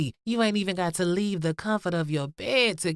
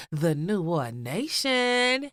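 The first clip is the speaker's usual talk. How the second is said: ordinary talk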